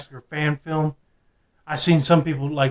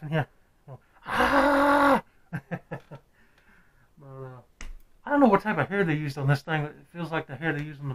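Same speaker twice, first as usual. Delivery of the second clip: muffled behind latex mask; speaking inside rubber mask; through a face mask. Real, not acted. Seen 1: Full latex horror mask covers whole face; mouth shows through opening.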